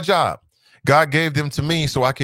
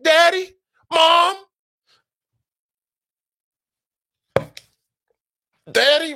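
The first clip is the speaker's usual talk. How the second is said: imitating son calling; 13 semitones higher, 3 dB louder, slower than usual. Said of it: "That boy wake up like".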